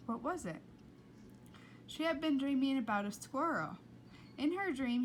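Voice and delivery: ordinary delivery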